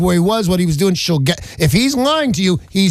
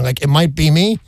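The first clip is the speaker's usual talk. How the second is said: ordinary talk, nothing marked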